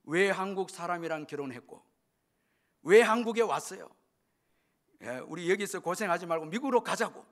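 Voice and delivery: mild acted child's outburst, loudest in the middle; retold tearful protest. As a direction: imitate an upset child confronting a parent. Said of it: "울면서 달라드는데". (울며 대드는 아이를 가볍게 흉내 내듯)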